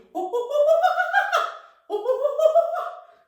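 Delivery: very high loud chimp cackle; chimp laugh. Shown as a demonstration. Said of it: "almost laugh at him".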